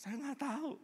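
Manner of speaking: high, thin distressed pleading, acted; helpless plea. Distraught woman tells pastor she doesn't know, helpless plea.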